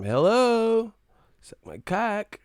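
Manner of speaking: high mocking call, drawn out. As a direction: pitch up and slow it to mock.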